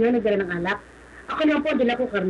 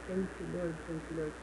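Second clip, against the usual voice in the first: very quiet, low prayer under the breath; murmured thanks; 5 semitones lower, 15 dB quieter than usual. Retold or imitated. Worker thanking the Lord while the employer scolds, murmured low. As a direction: murmur it softly and low, kept to yourself.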